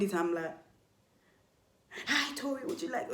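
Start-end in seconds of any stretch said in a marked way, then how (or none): 2.1-3.1 quoting the message with a raised pitch, a touch of a yell; mock shout. To the stranger in her DMs, a half-hearted mock shout. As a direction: read the hostile message out like a yell. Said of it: "okay, I'm shouting".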